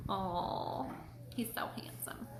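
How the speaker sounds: soft whispered aw; tender whisper. Real, not acted. Tender whisper over photo.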